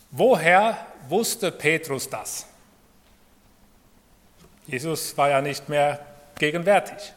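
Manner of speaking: ordinary talk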